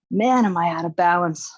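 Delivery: usual speaking voice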